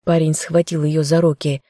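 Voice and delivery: plain reading voice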